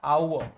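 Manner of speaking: "our" as au-uh, silent r; "our" ending on a schwa; correct pronunciation. Shown as a demonstration. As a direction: glide through "our" without a v. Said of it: "इसका जो pronunciation होता है".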